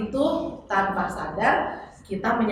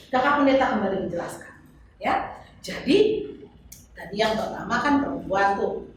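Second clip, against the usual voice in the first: usual speaking voice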